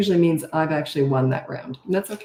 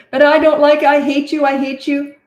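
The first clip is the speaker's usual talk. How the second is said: imitating a kid's whiny yell, higher and louder; mock child tantrum; 8 semitones higher, 7 dB louder than usual. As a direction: scream it like an angry little kid.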